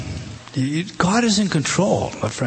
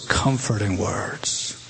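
comforting; 7 semitones lower, 7 dB quieter, slower than usual. A narrator's reading. God's gracious answer.

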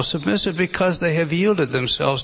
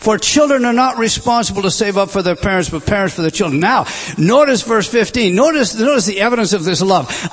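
loud, rising preacher's voice; a pastor pressing Paul's plea home; fervent preaching; 6 semitones higher, 4 dB louder than usual. Acted. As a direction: preach it with full fire. Preaching Paul's words at full force.

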